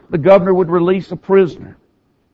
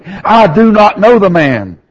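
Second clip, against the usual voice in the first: louder, slow, swearing he never knew him; cursing denial; 4 semitones higher, 5 dB louder than usual. Retold or imitated. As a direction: deny it with an oath, pushing the words out. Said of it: "began to curse and swear".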